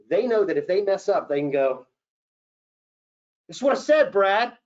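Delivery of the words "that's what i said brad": loud, high, defensive snap at Brad; a high loud flustered "Brad"; flustered correction. Shown as a demonstration. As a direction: blurt it out, caught off guard. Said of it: "they're not gonna go".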